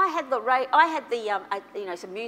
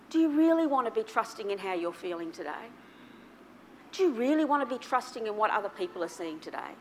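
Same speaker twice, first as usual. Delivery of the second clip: ordinary delivery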